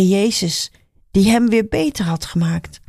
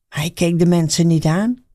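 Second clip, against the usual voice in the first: ordinary delivery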